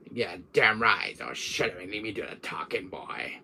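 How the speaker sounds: low, domineering villain taking over; villain voice, dismissive and commanding; Wesker. Acted. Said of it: "he'll take over my channel sometimes".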